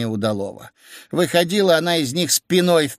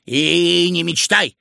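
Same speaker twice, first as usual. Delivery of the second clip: drawn-out cross refusal; slow, irritated old man snubbing the idea; crossly; 2 semitones higher, slower than usual. A narrator's reading. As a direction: stretch it out in annoyance. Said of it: "озлился старик".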